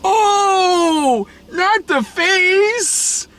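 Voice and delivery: very high, drawn-out mock kid cry; slow, squeaky-high child's outcry; kid heckler imitation. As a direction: imitate a kid wailing from the back row. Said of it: "The fucking kid in the back is".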